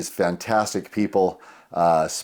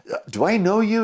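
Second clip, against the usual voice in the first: high-pitched imitation of a hesitant stranger; retold tentative question, pitched way up; curious stranger; 12 semitones higher than usual. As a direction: act out a puzzled onlooker. Retold stranger hesitantly recognizing him.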